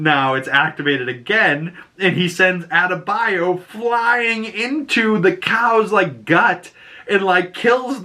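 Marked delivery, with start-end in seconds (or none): none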